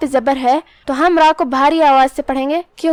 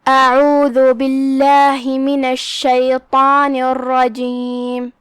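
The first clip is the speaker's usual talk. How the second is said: drawn-out, melodic tajweed recitation; measured chanting of scripture; slow Quran recitation; much slower than usual. Shown as a demonstration. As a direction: recite each word slowly, with tajweed.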